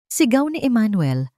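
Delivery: plain reading voice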